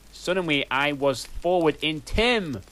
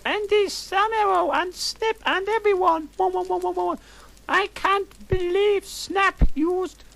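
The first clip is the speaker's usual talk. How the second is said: put-on squeaky high goth girl, breathless gush; shrill up in the head like a teen diva; high valley girl voice; 4 dB louder than usual. Narrator gushing to friends in a high valley girl voice.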